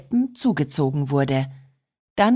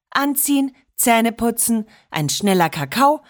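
ordinary delivery

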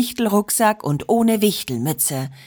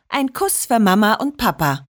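ordinary delivery

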